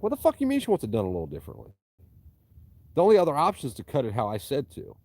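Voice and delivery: fast, loud and high, mock outrage; exasperated, half-shouted retelling; retold angry outburst.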